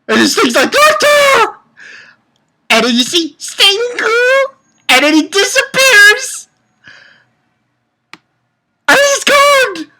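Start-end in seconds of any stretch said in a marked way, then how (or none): 0.7-1.6 high mock shriek; retold cry. Reviewer acting out Sting's cry for Lecter, high mock shriek. 2.7-9.9 very high, squealing delivery; high squealed retelling. Acted. Reviewer telling how the cat vanished, high squeal.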